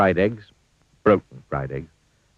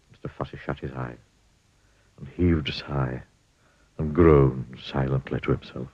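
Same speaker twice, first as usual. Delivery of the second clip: ordinary delivery, nothing marked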